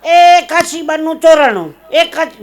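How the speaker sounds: high, very loud, drawn-out cry; sudden alarm shout. Acted. Night alarm in the train that Kashiba's been robbed, shouted. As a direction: raise the alarm across a sleeping carriage. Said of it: "ઓચિંતાની બૂમ પાડી".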